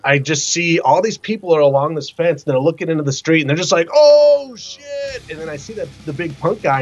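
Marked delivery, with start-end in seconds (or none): 3.9-5.2 high, drawn-out "ohh" of gawking onlookers; imitated crowd astonishment. Onlookers along a fence staring into the street, mock astonishment.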